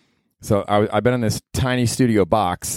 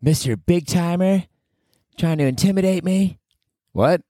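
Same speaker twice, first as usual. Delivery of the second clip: much higher, challenging put-on voice; mock tough guy; 9 semitones higher than usual. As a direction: square up to an imagined big shot, high.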